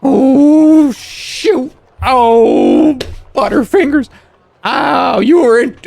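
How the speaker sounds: high, loud cries of dismay; dismayed cry. Acted. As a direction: yelp at your own blunder.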